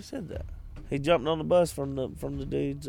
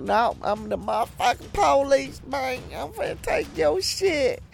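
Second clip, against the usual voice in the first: a lightly raised, slangy cop voice; put-on street accent; 11 semitones higher than usual. Cop telling a driver he's taking the car. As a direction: play the cop as a street hustler. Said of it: "You put all the wrong accents on people".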